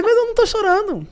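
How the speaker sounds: high mock stern reply; a light imitation of a stern leader; imitating Lula. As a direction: play the president brushing it off. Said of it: "Ele fez".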